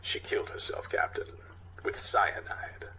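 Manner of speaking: grim detective whisper; whispered report. Acted. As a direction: hush your voice to break the news.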